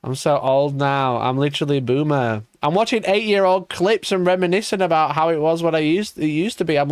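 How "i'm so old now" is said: ordinary talk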